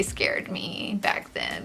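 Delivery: whispered, hushed recollection; whispering. Real, not acted. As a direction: drop to a whisper. Recalling fear, voice drops.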